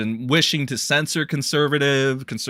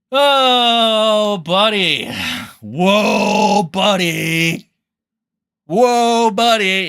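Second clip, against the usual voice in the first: loud drawn-out whoa buddy; mock exclaim; 6 semitones higher, 6 dB louder, much slower than usual. Streamer catching his own slur, mock alarm. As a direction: call yourself out, hammed-up.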